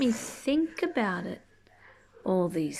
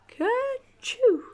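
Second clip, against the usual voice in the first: a big high hammed-up sneeze; loud mock sneeze; 6 dB louder than usual. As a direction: sneeze it out for the kids. Sneeze in the story.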